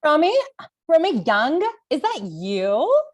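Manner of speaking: delighted surprise, voice jumping up on the name; calling out happily, unsure it's really her; surprised recognition. Acted. Lily spotting her old comedy rival in the cookie aisle, bright surprise.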